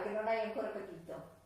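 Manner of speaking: usual speaking voice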